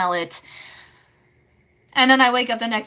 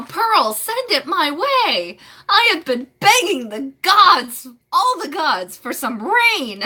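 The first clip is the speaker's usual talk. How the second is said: pleading for rain; 8 semitones higher, 5 dB louder, slower than usual. Real, not acted.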